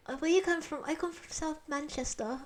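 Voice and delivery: high-pitched, unsure stab at Mancunian; mock Manchester accent. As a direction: put on a silly British voice from Manchester. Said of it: "the accent for there".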